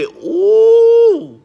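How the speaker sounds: high, loud, drawn-out hoot; high admiring whoop; swooning ooh. Real, not acted. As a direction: squeal a long "ooh" of delight. Swooning over Tom Cruise.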